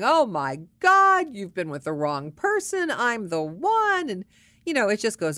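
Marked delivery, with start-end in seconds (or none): 0.0-4.1 stretched, loud, high mock gushing; gushing online suitors. Mimicking men's dating-site comments, hammed-up gushing. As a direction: imitate over-the-top admirers, drawing out the big words. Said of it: "all kinds of comments from men saying".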